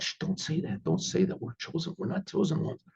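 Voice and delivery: soft, urgent shushing; hushed. Retold or imitated. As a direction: hush him before anyone hears.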